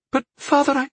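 high-pitched objection, broken off; protesting daughter. Acted. Daughter protests to her father, high and cut short.

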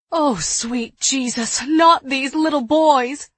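loud, slow, pleading outcry; anguished cry. A narrator's reading. Woman finding the twins sick, crying out loud. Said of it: "cried Eliza".

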